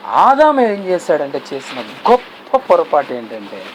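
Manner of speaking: usual speaking voice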